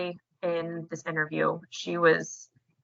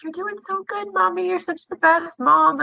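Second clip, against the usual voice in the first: put-on very high, sweet kid voice; encouraging child; 10 semitones higher, 4 dB louder than usual. Kids hugging and praising their mom, encouraging child voice. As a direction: imitate a small child cheering Mom on. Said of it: "they'll give me hugs and".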